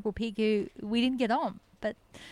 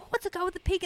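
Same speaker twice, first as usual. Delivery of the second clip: curious onlooker; 8 semitones higher, faster than usual. Retold or imitated. Voices curious passers-by at park.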